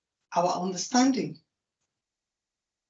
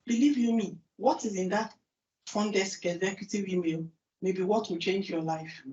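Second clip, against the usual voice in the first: usual speaking voice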